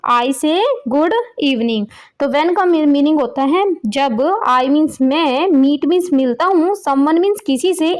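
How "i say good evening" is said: very slow, each word spelled out clearly; slow pronunciation demo. Shown as a demonstration. Teacher reading the sentence slowly aloud to her class. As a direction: read it slowly so students can copy every word. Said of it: "चलिए इसकी pronunciation देखते हैं".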